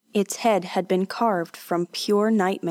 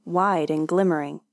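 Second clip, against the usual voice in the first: plain reading voice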